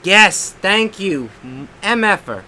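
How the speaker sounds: loud triumphant yes; real burst of victory; excited cheer. Real, not acted. Gamer celebrating a win, loud excited cheer.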